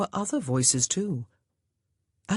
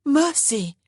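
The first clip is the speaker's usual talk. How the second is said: high, louder cry of alarm; 10 semitones higher, 5 dB louder than usual. A narrator's reading. Grace calling to her sobbing sister, crying out. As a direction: call out to her in concern, pitched up. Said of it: "Grace cried".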